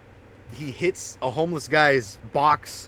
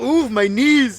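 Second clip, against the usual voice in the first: high, loud mock wail over aching knees; mock pain; 9 semitones higher, 7 dB louder, much slower than usual. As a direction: ham up the creaky-body complaint. Mock aches of getting old.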